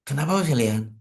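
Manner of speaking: ordinary talk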